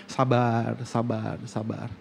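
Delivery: pelan dan jauh lebih lirih, menenangkan; lembut menenangkan. Retold or imitated. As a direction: bisikan hati yang menyabarkan. Tuhan mengingatkan dalam hati. (Tuhan mengingatkan untuk sabar, lirih dan pelan)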